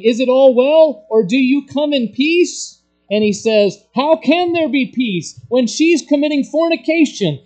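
high, loud and pressing; loud preaching quote. Retold or imitated. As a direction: hurl the question out like a challenge. Preacher shouts the dialogue.

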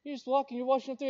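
usual speaking voice

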